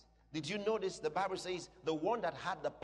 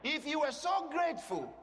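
high, challenging pulpit question; full-force preaching; 5 semitones higher, 3 dB louder than usual. Real, not acted. Preacher challenging those who announce deaths with regret, forceful preaching. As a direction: throw the question back at them forcefully.

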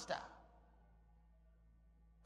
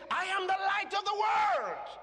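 proclaimed; 7 semitones higher, 4 dB louder than usual. Acted. Preacher proclaiming Jesus' words.

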